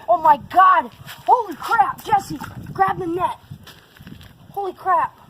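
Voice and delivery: excited shouting while reeling in; fighting a fish. Real, not acted. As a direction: yell at your brother, fish on. Landing a big fish.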